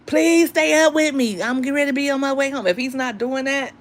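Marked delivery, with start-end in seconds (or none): 0.1-2.7 high-pitched mock begging; acted pleading. Man on his way home begging his woman, mock pleading. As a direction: plead with a partner to stay awake, pitched up.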